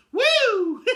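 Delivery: high, loud celebratory woo with a laugh; gleeful whoop. Real, not acted. Fan whooping with joy at the coach firing. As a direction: whoop like the coach is finally gone.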